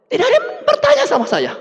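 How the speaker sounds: high, laughing punchline; laughing squeak of disbelief. Real, not acted.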